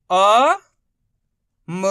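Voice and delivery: loud, held अ, then a separate म; drawn-out letter drill on अ and म; sounding out letters. Shown as a demonstration. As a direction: stretch अ and म one at a time. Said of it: "इनका उच्चारण किस तरीके से".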